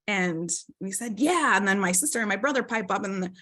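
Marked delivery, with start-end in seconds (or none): 1.2-1.6 put-on high, louder eager child yeah. Kids eagerly confirming to mom, high and louder. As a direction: kids jumping in to agree.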